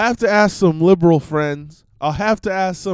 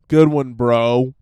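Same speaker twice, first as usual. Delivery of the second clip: sarcastic laugh-off; 5 semitones lower, slower than usual. Retold or imitated. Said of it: "Like a sarcastic laugh it off".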